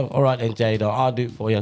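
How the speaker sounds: ordinary talk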